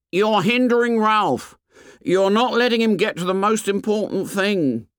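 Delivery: high, put-on boy's voice; indignant Piggy. A narrator's reading. Piggy scolds the assembly for hindering Ralph, indignant and high.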